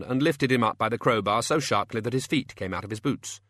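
ordinary delivery, nothing marked

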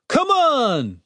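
high-pitched urging yell; impatient shout. A narrator's reading. Another guard hurrying the cleric, impatient shout. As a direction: holler it high, hurrying him along. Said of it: "shouted another guard".

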